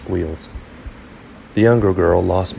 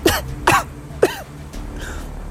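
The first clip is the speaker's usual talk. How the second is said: high, choked coughing fit; sputtering girlish coughs; 4 dB quieter than usual. A narrator's reading. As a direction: cough up swallowed seawater. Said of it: "She coughed and sputtered".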